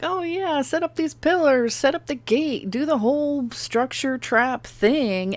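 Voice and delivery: mocking imitation. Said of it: "And everybody's just like".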